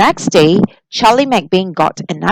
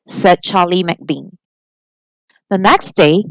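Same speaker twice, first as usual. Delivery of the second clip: ordinary delivery, nothing marked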